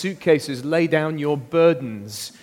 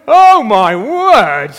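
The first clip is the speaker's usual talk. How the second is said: much louder and higher, slow "oh my word"; showman cheer; 9 semitones higher, 12 dB louder, slower than usual. Acted. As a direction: hype the crowd for her. Cheering child carrying burdens.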